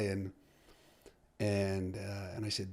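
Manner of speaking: ordinary talk, nothing marked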